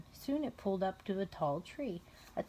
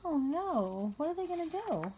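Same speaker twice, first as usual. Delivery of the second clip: much higher, breathless excitement; excited; 8 semitones higher than usual. Real, not acted. Mother to her child, excited storytelling. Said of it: "mother dramatizes to child".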